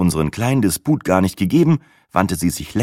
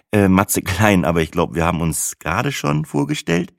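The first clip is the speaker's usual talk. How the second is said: plain reading voice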